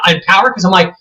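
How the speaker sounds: normal talking voice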